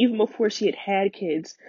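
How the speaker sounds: ordinary talk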